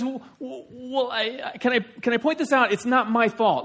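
high, stammering self-defence; arguing husband. Acted. Jacob pointing at Leah, high defensive protest. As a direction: protest it in acted exasperation. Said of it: "They're having an argument here".

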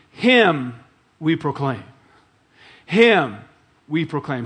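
"Him" punched loud before a pause, then "we proclaim"; stress on him. Shown as a demonstration. Preacher showing the Greek word order, "Him" stressed. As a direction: hit "Him" hard and let it hang. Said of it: "It, it goes".

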